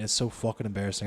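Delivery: ordinary talk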